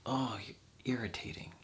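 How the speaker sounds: breathy mocking whisper of annoyance; hushed, put-upon mock complaint. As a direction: whisper it like a fed-up snob.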